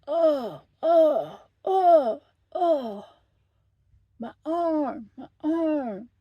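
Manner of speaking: hammed-up pained wailing, high and loud; mock moaning. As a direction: wail like a drama-queen patient. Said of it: "she's just sitting there".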